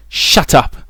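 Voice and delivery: high, loud, bitter burst; spat out. A narrator's reading. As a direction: spit it at him in anger. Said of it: "She spat".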